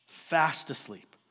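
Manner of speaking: normal talking voice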